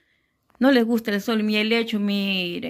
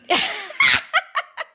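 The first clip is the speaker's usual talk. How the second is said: high laugh. Real, not acted. Laughing at daughter.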